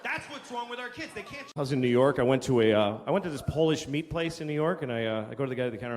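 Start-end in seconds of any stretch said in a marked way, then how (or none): none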